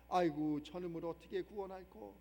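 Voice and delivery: low, sighing lament; a deep-voiced heavenly sigh over a lost soul; God's sigh, voiced low. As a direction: groan it out like an exasperated father. God sighing over a sinner.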